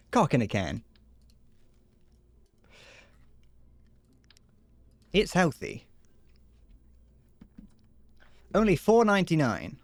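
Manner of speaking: hammed-up commercial voiceover; mock ad read. A streamer pitching a fake canned product, mock ad voice. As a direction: sell the product like a cheesy TV advert.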